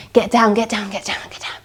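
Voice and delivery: hushed whisper, crouching low. Pretend. Teacher hiding from the witch, whispering. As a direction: whisper so the witch won't hear.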